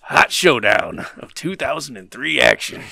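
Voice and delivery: loud, hyped-up showdown announcement; announcer voice. Pretend. Host introducing a retro card match, in a loud announcer voice. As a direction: sell the match like a hammed-up ring announcer. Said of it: "I like the voice".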